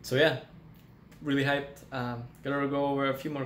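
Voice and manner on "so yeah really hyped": usual speaking voice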